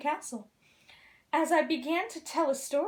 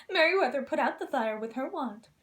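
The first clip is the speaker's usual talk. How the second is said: plain reading voice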